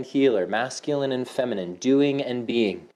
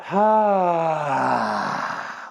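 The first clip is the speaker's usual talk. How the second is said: long sigh falling from high; open-mouthed release sigh out loud; loud audible sigh; 5 semitones higher than usual. Shown as a demonstration. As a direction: let it all out with a big sigh. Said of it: "sigh it out, out loud".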